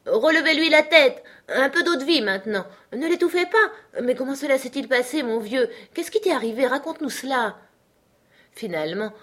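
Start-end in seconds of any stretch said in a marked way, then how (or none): 0.1-7.6 high-pitched, agitated bystanders; confused crowd voices. A narrator's reading. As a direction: fire off urgent cries like a flustered crowd. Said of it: "un chœur de voix confuses". (voix agitées et aiguës)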